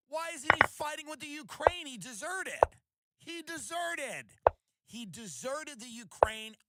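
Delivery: high, loud outraged argument; mock indignation. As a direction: hammer it home, stretched out and indignant. Relaying friend's indignant argument about worker.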